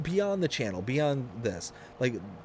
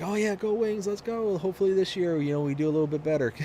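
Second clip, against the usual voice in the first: upbeat fan reply; 7 semitones higher, faster than usual. Retold or imitated. Retold own reply.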